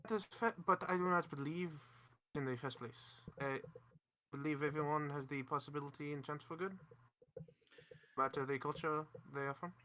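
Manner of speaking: put-on an overdone French accent; too French. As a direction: lay on the French accent thick. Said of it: "Going way too French".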